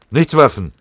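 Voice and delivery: loud, high, firm denial; emphatic, steady-nerved denial. A narrator's reading. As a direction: answer firmly and forcefully. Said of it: "mit fester Stimme".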